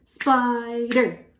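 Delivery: "Spider" stretched into two emphatic beats; syllable by syllable. Shown as a demonstration. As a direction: sound out "Spider", lengthening the first syllable. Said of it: "Two syllables in spider".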